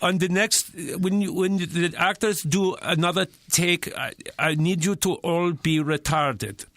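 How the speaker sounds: put-on earnest direction in a Finnish accent. Finnish client directing the next take, careful Finnish accent. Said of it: "And he goes".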